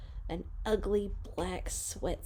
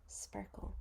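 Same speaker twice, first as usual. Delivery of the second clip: whispered word; 17 dB quieter than usual. A narrator's reading. Storybook hush on last word.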